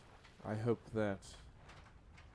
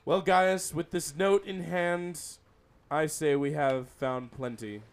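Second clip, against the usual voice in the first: raised voice; 9 dB louder than usual. Acted. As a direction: raise your voice to rally him. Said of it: "Andersmith then raises his voice".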